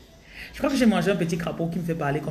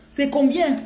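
high, loud mock Gabonese question; exaggerated Gabonese French lilt; Gabonese accent; 8 semitones higher, 6 dB louder, slower than usual. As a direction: repeat it in a teasing Gabonese accent. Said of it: "ils parlent comme les Gabonais".

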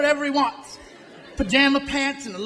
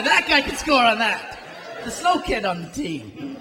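ordinary delivery

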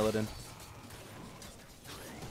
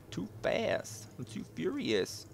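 whispered lyric; 6 semitones higher, 9 dB quieter, slower than usual. Pretend.

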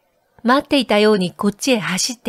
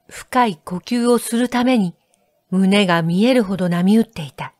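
ordinary delivery

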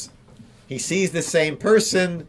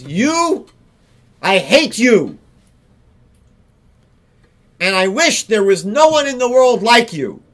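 loud, higher angry outburst; upset outburst; 4 semitones higher, 5 dB louder than usual. Acted. Upset man lashes out at patient Hillel, loud and angry. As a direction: lash out at him in frustration. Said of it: "This man gets very upset".

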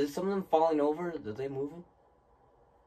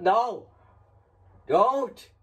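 yelling "don't" in panic, much higher and louder; scared shouting; 7 semitones higher, 7 dB louder, much slower than usual. Real, not acted. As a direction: shout at the screen in real fright. Scared player yelling at the game.

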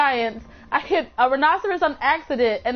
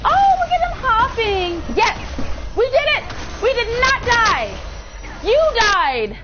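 high, triumphant cheering; really excited; 9 semitones higher than usual. Real, not acted. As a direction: crow over a narrow survival. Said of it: "I was really excited that I didn't die".

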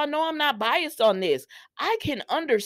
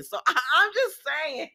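usual speaking voice

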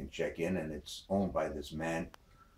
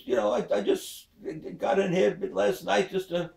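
put-on high girl voice, quick at the end; coy daughter; 14 semitones higher, 8 dB louder than usual. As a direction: sheepishly explain it away. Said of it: "She says".

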